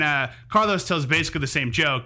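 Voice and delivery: ordinary talk, nothing marked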